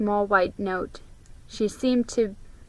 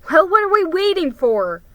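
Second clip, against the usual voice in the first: put-on higher, louder impatient boy; boy keen to open the box; eager boy; 9 semitones higher, 7 dB louder than usual. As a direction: hurry everyone up. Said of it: "said Ricky".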